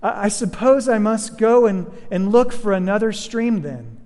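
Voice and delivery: put-on a timid girl reasoning anxiously; nervous girl. A narrator's reading. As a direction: Jill trying to slip away from the lion. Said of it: "And the lion replied to her".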